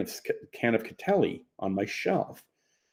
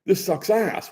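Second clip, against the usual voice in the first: frustrated yell; 10 semitones higher, slower than usual. Retold or imitated. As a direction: shout it out in annoyance. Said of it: "so he yells out".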